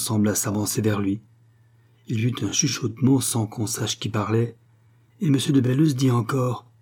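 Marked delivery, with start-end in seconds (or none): none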